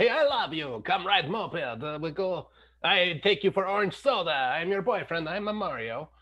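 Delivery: mock Italian moped flirt, thick Italian accent; Borat Italian impersonation. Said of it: "my Borat Italian impersonation".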